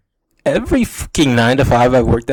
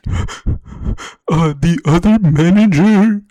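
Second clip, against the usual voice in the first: high, very slow, mock sobbing voice; imitating crying girl; 8 semitones higher, 3 dB louder, much slower than usual. Coworker imitating the crying teen employee, hammed-up sobbing. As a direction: stretch each word out like a teenager bawling. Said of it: "she's fucking bawling".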